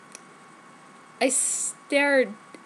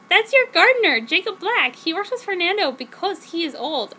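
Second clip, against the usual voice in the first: put-on high, louder teen girl voice; girly heroine voice; 6 semitones higher, 5 dB louder than usual. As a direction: play the gushing teenage heroine. Narrator voices herself in quotes.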